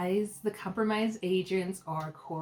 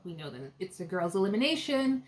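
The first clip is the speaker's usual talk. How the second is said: ordinary talk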